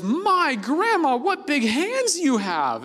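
put-on high, slow, wide-eyed girl voice; a little girl marvelling slowly.

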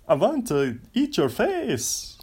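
put-on high, slow, hammy vampire accent; playful over-the-top Transylvanian count; Dracula voice. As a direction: draw it out like a mock vampire. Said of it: "you have to talk like Dracula".

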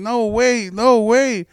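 high, loud drawn-out pleading call; mock yell. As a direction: call after someone running away, imitating. Acting out man chasing a woman.

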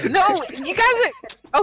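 breaking into laughter mid-protest; laughing protest. Real, not acted. Protesting to friends while laughing, laughing voice.

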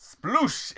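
high playful splash noise; splashing sound. Retold or imitated. Imitating ball landing in water.

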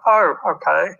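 imitating a posh local. Local man backs down.

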